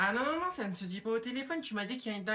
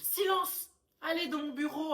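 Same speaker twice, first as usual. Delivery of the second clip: stern teacher; 8 semitones higher, slower than usual. Acted. Teacher ordering the quarrelling pupils to her office, stern. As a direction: command the class to be silent.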